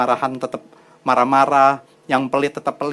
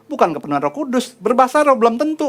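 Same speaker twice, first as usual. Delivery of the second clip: raised, emphatic preaching; 8 semitones higher than usual. Acted. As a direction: drive the point home to the congregation. Preacher rising to emphatic point. (khotbah penuh semangat)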